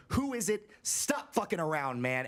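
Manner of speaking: panicked yell at whoever is outside; retold yelling. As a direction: shout at the door to stop the prank. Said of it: "we keep yelling".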